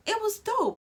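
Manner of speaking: high, loud burst of joy; almost squeal it with delight; thrilled, high and loud; excited. Real, not acted. Excited exclamation.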